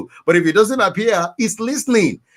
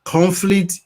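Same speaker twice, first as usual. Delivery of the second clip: ordinary talk, nothing marked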